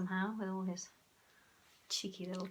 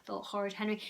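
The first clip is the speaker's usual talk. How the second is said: ordinary delivery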